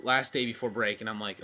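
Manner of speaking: usual speaking voice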